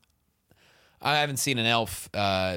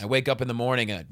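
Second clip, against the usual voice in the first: ordinary talk, nothing marked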